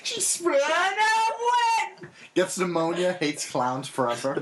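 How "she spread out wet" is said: mock crying.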